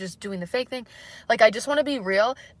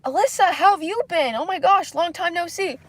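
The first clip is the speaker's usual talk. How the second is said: Kevin's greeting; 9 semitones higher, 4 dB louder than usual. Retold or imitated. Imitating Kevin greeting her at work in a high cheery voice. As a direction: mimic an overly bright coworker calling her name. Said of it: "and it's like".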